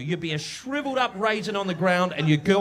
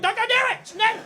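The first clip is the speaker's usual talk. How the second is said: mock panicked warning; 14 semitones higher, faster than usual. Comic Kiwi guarding a fifty-year-old fence, high mock alarm. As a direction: yell it like a frantic guard shooing people off.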